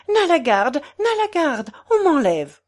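high-pitched mock alarm; cry for help. Portress being carried off calls the guard, alarmed cry. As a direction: call out for rescue, pitch climbing.

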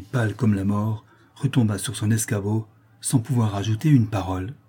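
ordinary delivery

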